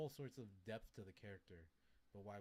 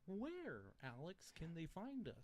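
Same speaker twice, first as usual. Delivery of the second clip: high, bright announcer sign-off; hammy host voice; 9 semitones higher, 4 dB louder, much slower than usual. Pretend. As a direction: play it like a cheesy radio host. Hammed-up podcast host sign-off.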